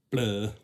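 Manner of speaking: loud, blurted "blah" in imitation; booming imitated blah; Aunt Tam. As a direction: mimic someone with one loud silly noise. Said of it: "She talks like this".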